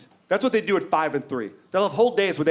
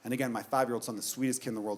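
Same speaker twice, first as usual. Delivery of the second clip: usual speaking voice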